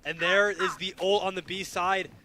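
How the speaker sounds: loud, urgent commentary building up; excited play-by-play. Real, not acted. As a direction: call the action as it happens. Live play-by-play, push on site.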